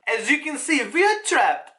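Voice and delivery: high, hammed-up vampire-like accent; Ivankov. Reader failing at Ivankov's voice, botched vampire accent. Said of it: "It just sounds like I'm a vampire".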